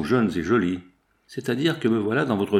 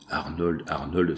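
softer, lower, understated dread; distraught plea; 5 semitones lower, 4 dB quieter, slower than usual. A narrator's reading. Frightened dressmaker calling out to Arnold, understated distress. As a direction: read the trembling cry as a hushed, mild appeal. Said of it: "s'écria l'essayeuse tremblante, éperdue".

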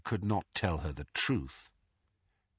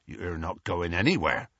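a lightly growled Hagrid line; low, firm half-growl; 3 semitones lower than usual. A narrator's reading. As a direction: Hagrid firmly refusing to let Harry leave, mild growl. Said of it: "growled Hagrid".

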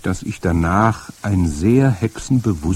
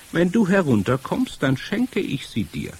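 high-pitched luring stranger; eerie high-voiced woman; strange witch voice; 8 semitones higher than usual. A narrator's reading. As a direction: coax the child in a high witchy voice. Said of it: "Ihre Stimme hatte einen merkwürdigen, rasselnden Klang".

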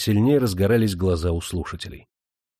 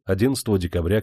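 plain reading voice

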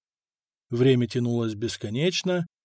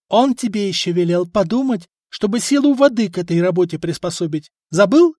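higher, louder, needling; snide; 6 semitones higher, 5 dB louder than usual. A narrator's reading. Wife needling her blacksmith husband, snide. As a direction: twist the knife with the last word. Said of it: "ехидно спросила Эльфрида".

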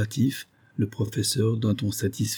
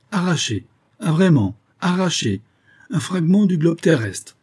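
high, mocking echo; scornful disbelief; 8 semitones higher, 4 dB louder than usual. A narrator's reading. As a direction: repeat the theory as if it were absurd. Professor scornfully echoes captain's theory.

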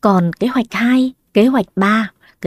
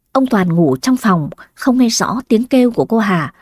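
plain reading voice

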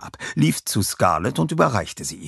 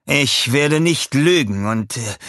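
slow, higher-voiced, hesitant hurt; sad complaint; 6 semitones higher, 5 dB louder, slower than usual. A narrator's reading. Inventor Herb admitting he is hurt, sad complaint. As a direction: draw it out, then falter. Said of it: "sagte er traurig".

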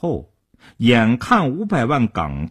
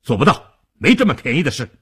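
a mildly raised, firm refusal; loud refusal; 3 semitones higher, 3 dB louder, faster than usual. A narrator's reading. Gangster refusing a caller's demand on the phone, raised voice. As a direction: refuse point-blank with some force. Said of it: "大声说道".

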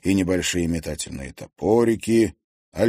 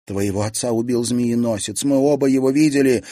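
put-on pleading young woman; 5 semitones higher, 4 dB louder than usual. Serafima argues back.

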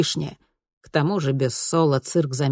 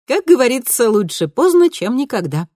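put-on higher, brighter girl voice; lightly voiced cheerful teen girl; bright daughter voice; 8 semitones higher, 6 dB louder than usual. A narrator's reading. Daughter continues.

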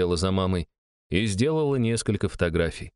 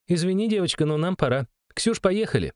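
higher, friendly but hurried voice; young woman voice; 10 semitones higher than usual. A narrator's reading. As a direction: wrap it up kindly and call a friend. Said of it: "Irina leaving".

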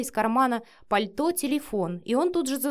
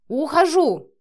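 loud, high angry bark; 7 semitones higher, 8 dB louder, slower than usual. A narrator's reading. Marina announces she is leaving, barking. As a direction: bark it out and storm off. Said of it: "рявкнула Марина".

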